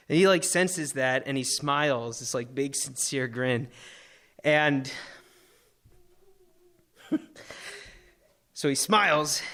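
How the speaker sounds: ordinary talk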